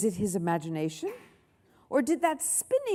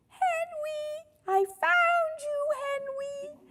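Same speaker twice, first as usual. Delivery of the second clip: piping little voice; slower than usual. A narrator's reading. Lily clinging to Henry's leg, piping voice. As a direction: squeak it like a tiny clinging child. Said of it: "piped the creature".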